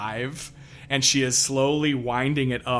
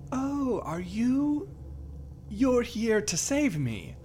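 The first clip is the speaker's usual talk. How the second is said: higher, slow hopeful lady; drawn out, lightly wondering; lightly voiced hopeful heroine-seeker; hopeful npc voice; 9 semitones higher, slower than usual. Npc's hopeful question.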